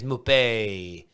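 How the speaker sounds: drawn-out French "Mopay"; French-accented "Mopay", last syllable held; French pronunciation. Shown as a demonstration. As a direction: say "Mopay" with a put-on French flourish, stretching the end. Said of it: "that sounds French".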